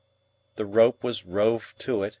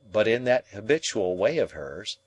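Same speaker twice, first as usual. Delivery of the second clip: ordinary delivery, nothing marked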